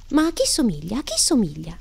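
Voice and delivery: put-on high, eager child's question; bursting with curiosity, voice leaping up; excited exclamation. A narrator's reading. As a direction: exclaim it with swooping pitch. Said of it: "esclamò Alice".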